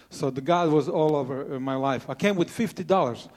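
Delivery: ordinary talk, nothing marked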